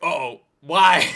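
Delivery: ordinary talk, nothing marked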